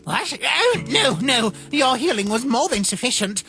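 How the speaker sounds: high, loud, startled protest; taken aback and insisting; flustered denial. Acted. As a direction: hurriedly wave away the worry. Flustered denial of being hurt.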